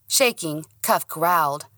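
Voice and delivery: usual speaking voice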